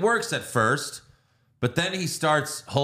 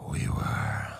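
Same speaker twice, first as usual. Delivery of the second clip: unvoiced whisper; whispered confession; 4 dB quieter than usual. Host quoting Eric about Raven, a sudden whisper. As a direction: breathe it out like a secret. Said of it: "Eric's like".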